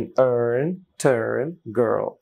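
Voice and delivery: put-on American r sounded in "earn, turn, girl"; American style. Shown as a demonstration. Teacher demonstrates for learners, American accent. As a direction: curl the American r in each word. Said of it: "American স্টাইলে বলার চেষ্টা করছি".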